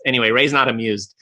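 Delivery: ordinary talk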